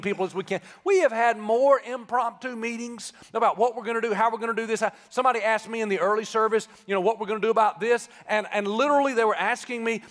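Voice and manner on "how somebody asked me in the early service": ordinary talk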